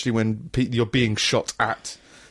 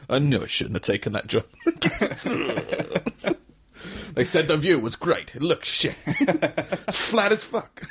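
put-on vague Eastern European accent; 4 semitones higher than usual. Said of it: "don't know what accent that was in".

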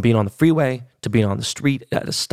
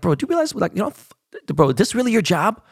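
retold disbelief; 9 semitones higher than usual. To the photo seller pushing pricey pictures, mock disbelief. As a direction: pitch jumps much higher in mock disbelief. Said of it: "And I'm thinking, like".

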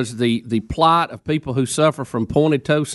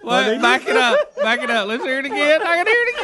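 high-pitched, laughing through the words; can barely get it out for laughing; 8 semitones higher than usual. Real, not acted. Host laughing at replayed gaffe.

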